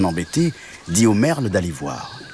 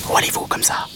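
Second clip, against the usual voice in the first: whispered mantis question; all breath, no voice; tiny insect; faster than usual. Acted. Mantis questions the cow, whispered. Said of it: "demanda l'insecte".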